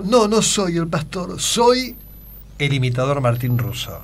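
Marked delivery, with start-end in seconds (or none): 0.0-2.0 pastor voice. Retold or imitated. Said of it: "Y ahí le cambié la voz".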